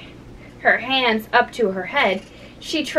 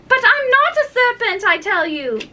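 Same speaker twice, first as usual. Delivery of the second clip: high-pitched protest, settling at the end; acted indignation, starting high; indignant protest; 11 semitones higher than usual. A narrator's reading. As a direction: insist on it in a high girlish voice. Alice again protests the pigeon's accusation.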